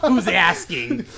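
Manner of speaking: slow, drawn-out noir detective; L A Noire style. Acted. Friend imitating a noir hero over cheap whiskey, drawn out. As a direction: stretch it out like a hammed-up noir line. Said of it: "one of those L A Noire style".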